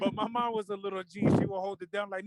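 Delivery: ordinary talk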